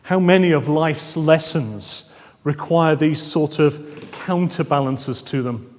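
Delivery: ordinary delivery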